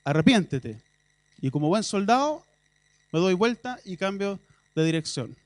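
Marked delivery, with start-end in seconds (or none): 0.0-0.8 military order. Retold or imitated. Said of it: "Esa era la palabra".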